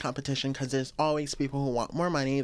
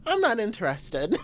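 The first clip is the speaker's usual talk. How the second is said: flat dismissal; 6 semitones higher than usual. Retold or imitated. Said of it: "I was like".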